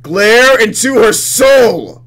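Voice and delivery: slow, loud, high-pitched cry; dramatic shout. Real, not acted. Player relishing the choice to glare at the rival, dramatic.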